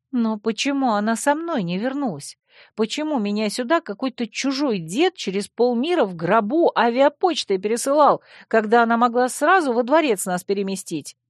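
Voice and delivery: indignant princess asking why; young heroine voice. A narrator's reading. Heroine voice, upset.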